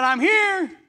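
high, loud call; Peter at the door. Retold or imitated. Peter calling out at the door to the praying house. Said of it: "and said".

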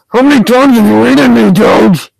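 high, loud exasperated demand; angry shout. Real, not acted. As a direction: yell the accusation at a rival player. Repeats complaint, now shouting at Doge.

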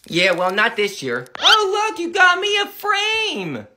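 ordinary talk

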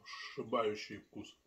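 speaking between bites; chewing while talking. Real, not acted. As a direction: mouth partly full of food. Seen 2: Took a bite at -5; jaw and lips still working while speaking.

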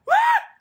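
sudden high laughing shriek; laughing scream. Real, not acted. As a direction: yelp then laugh as you die. Dies in game, laughs.

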